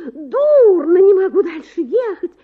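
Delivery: a fainting lady crying out to her companion. A narrator's reading. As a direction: wail that you can't go on. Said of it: "кричит".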